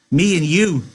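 high, loud, happy chime-in; excited Lennie. A narrator's reading. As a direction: chime in with childlike joy. Lenny joins in the dream.

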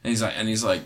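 ordinary talk, nothing marked

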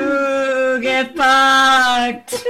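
a light spooky ghost moan. Pretend. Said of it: "You have to do that in a ghost voice".

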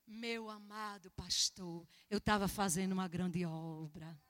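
low, soft, drawn-out reply; meek answer. Retold or imitated. Missionary explaining herself to her pastor, meek and soft.

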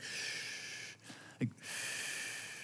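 long, drawn-out soft shh; demonstrated shush. Shown as a demonstration. Dad's universal sign with his girls, hushing shh. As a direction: shush with breath alone. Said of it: "This is my universal sign with my girls".

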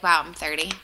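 usual speaking voice

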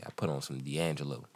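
low, quiet smooth pimp; low smooth voice setting the mood; sensitive pimp voice. Acted. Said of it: "a pimp who ... cares about your feelings".

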